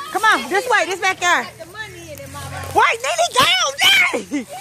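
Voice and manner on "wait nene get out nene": sharp high shout of a name. Real, not acted. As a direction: yell her name to stop her. Yelling at another child.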